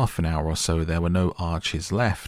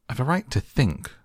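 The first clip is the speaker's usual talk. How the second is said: mildly sharp retort, higher; Alice mildly sharp with the Duchess, higher; said sharply; 7 semitones higher than usual. A narrator's reading. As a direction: snap back, a touch higher. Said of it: "said Alice sharply".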